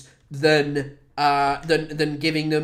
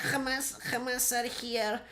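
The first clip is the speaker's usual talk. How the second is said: put-on the Israeli accent repeated much softer, slow; 5 semitones higher, 10 dB quieter than usual. Echoing his own mock line, softer Israeli accent. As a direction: drop to a soft, slow Israeli-accent echo. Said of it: "that's the Israeli accent".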